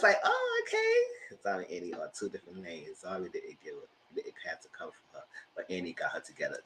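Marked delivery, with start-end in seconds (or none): 0.2-1.4 slow, high skeptical oh okay; drawn-out oh okay. Retold or imitated. Recalling her doubtful reaction, slow and high. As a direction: stretch it out unconvinced.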